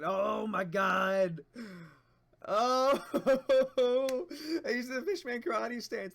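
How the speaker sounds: loud high delighted; excited shout. Real, not acted. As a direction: scream with joy. Hyped reaction to reveal.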